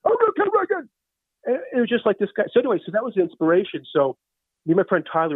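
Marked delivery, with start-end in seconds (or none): 0.1-0.9 high-pitched Rusty outburst; ranting yell. Acted. Rusty raging about hating Reagan, high-pitched rant. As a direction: rant it in a high, yelling character voice.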